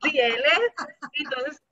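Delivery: laughing proudly about her son. Real, not acted. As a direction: laugh while saying it, amused by her son.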